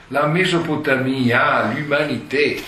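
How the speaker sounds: loud, lofty, high declamation; solemn pathos. Retold or imitated. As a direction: pronounce it as a grand revelation. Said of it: "con il mismo tono patetico". (tono patetico e solenne)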